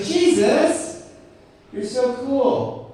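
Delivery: high, slow starstruck praise; mock fan gushing. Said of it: "he's just like".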